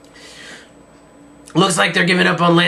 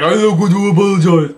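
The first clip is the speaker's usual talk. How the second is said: imitating Zeke; 2 semitones higher, 5 dB louder than usual. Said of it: "Big Zeke is like".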